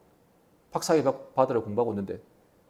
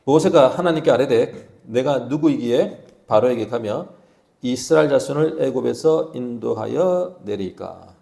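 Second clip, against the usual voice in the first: scripture reading; 5 semitones lower, 3 dB louder, slower than usual. Shown as a demonstration. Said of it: "같이 읽을게요. 시작".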